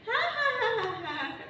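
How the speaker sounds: high, drawn-out "ha-ha-ha"; acted storybook laugh at someone's mishap; mocking laugh. Bidadari tertawa mengejek. (tawa mengejek bernada tinggi)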